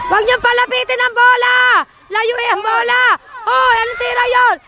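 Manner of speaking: real spectator shouting; shouting to players. Real, not acted. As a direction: yell instructions across the field. Calling out to players mid-match.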